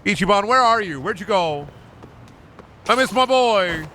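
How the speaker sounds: high plaintive holler; calling out. Pretend. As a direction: call for a lost friend, hammed up. Calling out for absent game character.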